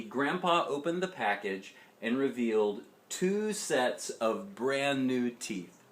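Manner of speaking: ordinary delivery, nothing marked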